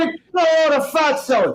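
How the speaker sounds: high joking shout; mock call-out. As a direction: yell it out like a playful taunt. Calling out a mock insult.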